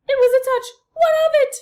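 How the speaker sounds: very high, loud indignant mother; French mother mouse. A narrator's reading. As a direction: defend her son with a flourish. Mother defends Despereaux.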